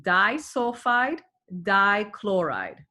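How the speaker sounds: slow, each syllable drawn out; over enunciating. Shown as a demonstration. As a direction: spell it out with your voice, slowly. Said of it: "let me say it slowly".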